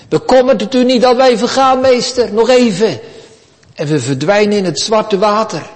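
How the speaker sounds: frightened disciples crying to the master; panicked disciples. Acted. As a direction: shout over the storm in terror. Terrified disciples in the storm.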